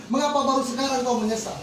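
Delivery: ordinary delivery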